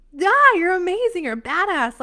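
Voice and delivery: loud, high burst of enthusiasm; excited hyping-up. Real, not acted. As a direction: cheer them on at full volume. Hyping up the listeners.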